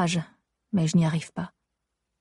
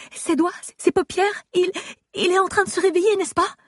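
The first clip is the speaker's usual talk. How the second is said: much higher, fast and eager; breathless hope; 12 semitones higher than usual. Acted. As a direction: rush it out, hoping. Excited question to nurse.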